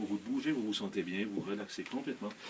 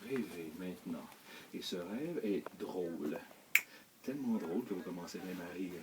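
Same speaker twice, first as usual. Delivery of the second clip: hypnotic suggestion; 3 semitones lower, 7 dB quieter than usual. Acted. Hypnotist suggests a funny dream to sleepers, hypnotic suggestion.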